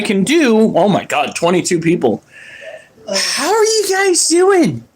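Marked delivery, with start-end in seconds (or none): 3.1-4.8 high, slow cheery host greeting. Real, not acted. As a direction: welcome the viewers brightly. Greeting stream viewers.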